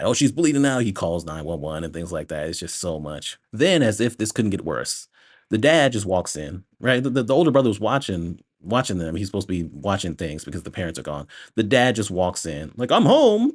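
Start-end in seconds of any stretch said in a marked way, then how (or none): 0.0-0.8 higher-pitched mock alarm; mock panic. Boy acting like she's about to die, mock panic. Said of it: "acting like she's about to die". 12.9-13.5 loud, high-pitched announced arrival; mock booming entrance. Dad just walks in out of nowhere, mock loud announcement.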